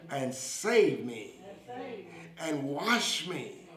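slow short phrases with long pauses for repetition; leading a prayer. Real, not acted. Preacher has viewers repeat a prayer for salvation, slow and fervent.

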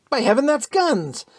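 high, loud startled cry; sudden high shout of alarm; excited yell. A narrator's reading. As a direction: shout it as the guns go off. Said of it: "the American yelled".

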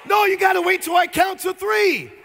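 high loud laughing rebuke; playful shout. Real, not acted. Preacher to eager crowd, loud high playful shout. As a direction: call them back, amused. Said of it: "correcting the crowd".

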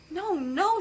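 high, loud desperate protest; crying out. Acted. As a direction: plead through tears. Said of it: "she cried".

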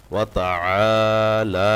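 وتعالى with the ain from the throat, both alifs stretched; very slow, full وتعالى; drawn-out demo. Shown as a demonstration. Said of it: "আইনে ... খালি আলিফ মদের হরফ". (আইন গলায়, এক আলিফ টান)

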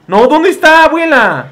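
high, loud wail to grandma; exasperated call. Pretend. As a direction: cry out for directions, exasperated. (reclamo agudo a la abuela)

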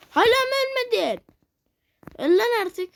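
urging the enemy to die, exasperated; frustrated yell. Real, not acted. Fight in game, yelling at enemy. (sinirli bağırış)

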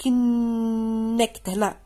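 كنكت stretched very slow; slow stress demo. Shown as a demonstration. Teacher explaining the stressed syllable, slow. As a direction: draw out كنكت to show the stress. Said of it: "كيفاش عرفتيها جات هنايا؟".

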